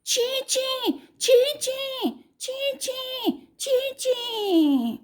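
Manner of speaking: high chirping bird cries. Acted. The bird crying out in the forest, high chirping. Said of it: "चिड़िया जोर से है चिल्लाई".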